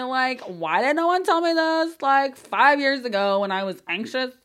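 high and loud, disbelieving; half-shouted amazement; exasperated excitement. Real, not acted. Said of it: "sometimes I feel like I'm screaming".

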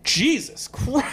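stunned exclamation, high and drawn out; slow, high-pitched mock amazement; retold astonishment. Acted astonishment at striking man.

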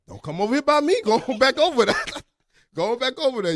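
loud, high mock protest; alarmed shooing away. Man shooing his scared friend away, mock-alarmed. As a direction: send him off loudly, half laughing.

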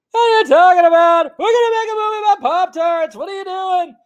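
high, loud whiny Seinfeld squeal; terrible Jerry Seinfeld. Retold or imitated. Streamer mocking Seinfeld's Pop-Tart movie, in a high whiny voice. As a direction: ham up a bad Seinfeld bit. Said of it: "That's my terrible Jerry Seinfeld impression".

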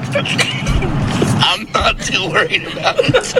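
laughing through the words; giggling while speaking. Real, not acted. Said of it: "I'm not laughing 'cause I think you're crazy".

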